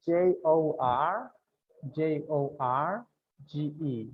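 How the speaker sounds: letters spelled slowly, one by one; slow spelling. Shown as a demonstration. Teacher spelling a name slowly for students. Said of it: "¿Cómo lo deletreas?".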